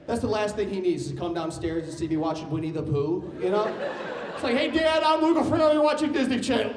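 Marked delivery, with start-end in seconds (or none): 4.6-6.7 mock shout. Mock announcing self to dad.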